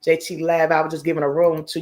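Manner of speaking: ordinary talk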